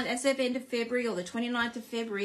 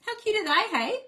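much higher and louder, gushing over cute cows; real excitement over the cows; excited gushing; 10 semitones higher, 6 dB louder, faster than usual. Real, not acted. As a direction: squeal over how cute they are. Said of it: "I got very excited about my cows".

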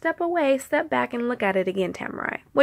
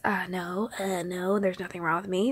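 lower, softer, hesitant denial lifting at the end; response as heard; 3 semitones lower, 5 dB quieter than usual. Retold or imitated. Friend denying a problem as she heard it, hedging. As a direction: hedge the no, end on a rise. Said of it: "That's how I heard it".

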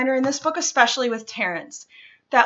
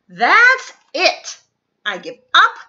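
louder, frustrated give-up; fed-up outburst; 5 semitones higher, 6 dB louder than usual. Acted. Turtle done with shells, louder frustrated outburst. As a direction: throw up your hands and quit out loud.

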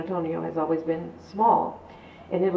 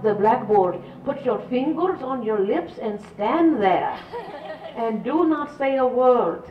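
higher Irish nun voice with "Patty Brooks" called out rising; scolding Irish nun; 5 semitones higher than usual. Retold or imitated. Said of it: "And they say".

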